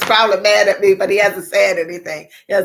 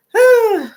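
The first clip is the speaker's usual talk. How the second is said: high loud whew; 6 dB louder than usual. Real, not acted. Big exhale sigh.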